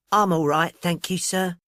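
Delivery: put-on small, timid Cockney girl; shy Cockney voice, nervous; timorous Cockney voice. A narrator's reading. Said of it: "in a timorous little Cockney voice".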